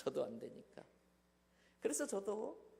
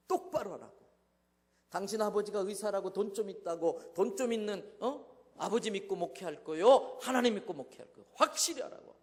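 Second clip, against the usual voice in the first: rising furious rebuke; angry outburst at wife; 2 semitones higher, 8 dB louder than usual. Retold or imitated. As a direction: tear into her for hiding the money. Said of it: "그날 제가 발칵 뒤집어 놨습니다".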